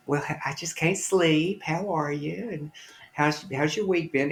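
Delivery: a hint of a chatty mom hiding nerves; mother on phone. Mother voiced on late call.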